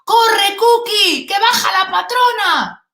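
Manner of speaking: high, loud warning shout; retold shout. Worker shouting down the shop floor that the boss is coming, loud and high. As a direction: yell the alarm to a coworker. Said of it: "escucho una voz que dice".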